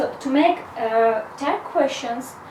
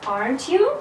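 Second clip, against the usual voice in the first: "Aren't you?" rising sharply on "you"; rising intonation. Shown as a demonstration. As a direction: lift the end of "Aren't you?" as a real question. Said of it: "I use rising intonation".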